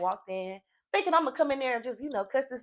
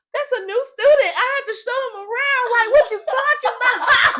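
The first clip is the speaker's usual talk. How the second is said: mock innocent excuse; 13 semitones higher, 7 dB louder than usual. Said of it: "I'm like".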